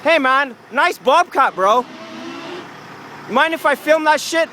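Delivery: high, loud put-on friendliness to the workers; fake nice. Filming vlogger hails the bobcat workers, loud and fake-friendly. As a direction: sweeten it up, bro, all fake charm. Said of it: "I gotta be nice".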